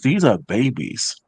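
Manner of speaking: usual speaking voice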